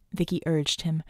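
ordinary delivery, nothing marked

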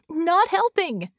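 high, loud, drawn-out exasperation; frustrated. Acted. Vicky rebukes Red's hopeless facts, frustrated. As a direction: snap back with stretched-out frustration. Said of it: "screamed in frustration".

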